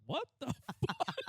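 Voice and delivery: high, slow, laughing outrage; laughing imitation of a shocked cashier; laughing mock shock. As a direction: squeal it through laughter. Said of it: "She's like".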